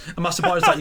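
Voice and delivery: usual speaking voice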